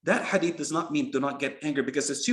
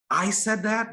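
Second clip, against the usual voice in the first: high, louder acted disbelief; incredulous; 7 semitones higher, 4 dB louder, slower than usual. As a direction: play a king stunned by his own order. King shocked at his own order.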